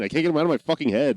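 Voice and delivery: normal talking voice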